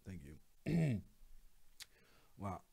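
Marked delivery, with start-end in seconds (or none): none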